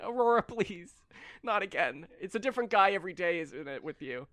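weary counselor. Acted. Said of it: "she's just like".

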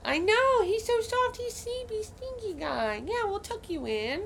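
high-pitched gushing over a pet. Real, not acted. Owner fussing over her sleepy stinky pet, high cooing baby talk.

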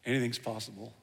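hushed. Acted. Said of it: "hushed key phrase".

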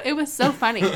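usual speaking voice

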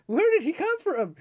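high, loud incredulous squeak; shocked squeal. Retold or imitated. Fan seeing the graphic, squeaking in high shocked disbelief.

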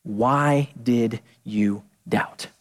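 low, slow and tender; gentle question. Acted. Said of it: "he said".

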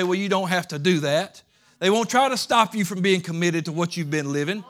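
loud, rising preacher's cry; preaching at full force. Acted. Preacher pressing the congregation, rising to a shout. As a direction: climb hard into a shout of conviction.

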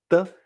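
的 brief and unstressed; neutral tone on 的. Shown as a demonstration. Teacher names the neutral tone, 的 short and light. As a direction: clip 的 into a short syllable. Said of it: "el tono neutro".

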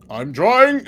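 high, loud strained cry; a guard wrestling a horse, calling out; harried guard yelling back; straining caravan guard. Acted. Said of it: "you see the caravan guard just".

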